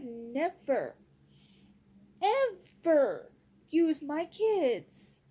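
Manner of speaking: NEVER, EVER stretched out hard; slow, punched words on never and ever; indignant emphasis. Real, not acted. Indignant vow as a mother.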